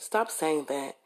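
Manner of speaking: ordinary delivery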